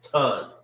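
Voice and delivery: Tan as a single sound, 'tahn'; one-syllable pronunciation. Shown as a demonstration. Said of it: "It forms one sound".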